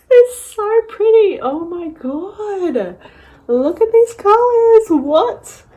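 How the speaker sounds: high, loud excited gush; delighted squeal. Real, not acted. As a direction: burst out with delight. Bursting with delight.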